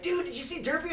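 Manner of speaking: high excited question; a thrilled fan asking. Retold or imitated. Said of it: "someone was like".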